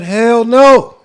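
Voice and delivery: imitating Gil's loud refusal. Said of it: "Gil said".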